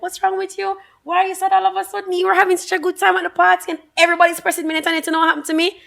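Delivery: high, hurried retold questioning; Jeff. Jeff asking why the mood changed, high hurried mimicry. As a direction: lightly voice a puzzled friend, high and fast. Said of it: "And Jeff is like".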